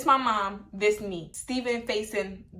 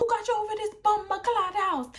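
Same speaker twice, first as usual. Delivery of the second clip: lightly put-on Jamaican accent, pitched higher; 11 semitones higher than usual. Stepdad confronts the boy outside, higher mock Jamaican accent. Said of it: "his little Jamaican accent".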